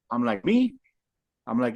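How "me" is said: high, startled me; surprised me. Retold or imitated. A homeless man startled when a stranger calls him over, high surprised question. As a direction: squeak it up like you can't believe she means you.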